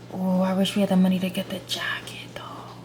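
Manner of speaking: hushed wishful whisper. Real, not acted. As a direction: whisper it like a secret wish. Wishful aside while shopping.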